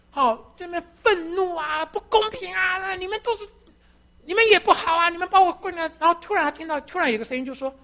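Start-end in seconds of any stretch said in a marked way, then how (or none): 0.1-5.9 raised, pained cries of protest; half-sobbing angry shouts, pitched high; mock cursing crowd. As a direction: act out the crowd raging at the cross. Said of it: "我们都在那里叫骂".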